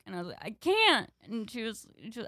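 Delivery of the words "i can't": high, loud mock protest; retold kid panic, pitched way up; panicked protest. Little sister refusing the tampon, panicked protest.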